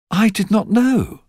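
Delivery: put-on startled young man. A narrator's reading. Narrator voicing the young man, surprised. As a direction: plead ignorance, caught off guard.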